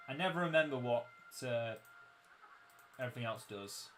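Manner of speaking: usual speaking voice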